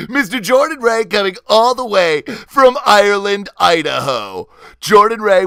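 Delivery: big, high, loud podcast announcer voice; hammed-up announcer. Host introducing the week's guest, hammed-up announcer. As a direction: stretch the names like a showy emcee. Host's show-opening announcer act.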